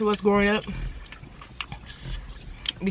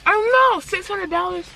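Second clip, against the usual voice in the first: shocked; 10 semitones higher, 3 dB louder than usual. Real, not acted. Echoing a shocking price.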